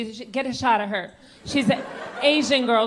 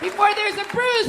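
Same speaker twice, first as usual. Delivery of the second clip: mock urgency; 7 semitones higher than usual. Urgent rush about banana.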